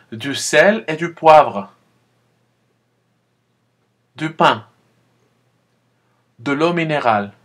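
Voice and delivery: loud, clear teacher model; model repetition. Shown as a demonstration. Teacher giving words for students to repeat, loud. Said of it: "répétez après moi".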